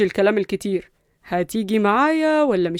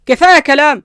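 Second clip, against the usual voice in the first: loud, raised interruption; firm command, pitched up and loud; cutting in; 5 semitones higher, 8 dB louder than usual. Acted. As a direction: cut them off sharply. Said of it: "قاطعها قصي".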